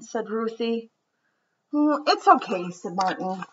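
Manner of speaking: gentle storybook read-aloud; children's story narration. A narrator's reading. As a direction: read it like bedtime story time. Reads picture book aloud.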